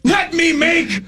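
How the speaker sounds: high, slow furious yell; angry shout. Retold or imitated. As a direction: blow up at the interrupting twin. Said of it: "Randy's just like".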